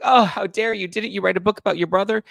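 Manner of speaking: high, loud mock outrage imitating her; hammed-up indignant screech. Imitating a rival's outraged retort, high and loud. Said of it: "She's like".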